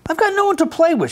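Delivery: high, fast whiny duck complaint; a sulky duck moaning to Dog; grumpy duck voice. A narrator's reading. Sulky duck whining to Dog, high and fast. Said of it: "she said to Dog".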